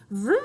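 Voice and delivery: loud vroom engine noise. Shown as a demonstration. Making the vacuum's vroom for the children. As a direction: zoom the vacuum sound. Car sound for kids.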